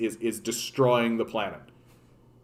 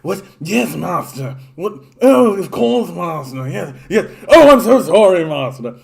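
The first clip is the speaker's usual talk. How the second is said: high, loud, fawning servant voice; Renfrew; 6 semitones higher, 9 dB louder than usual. Acted. Servant Renfrew groveling to his master, high and fawning. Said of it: "that Renfrew character".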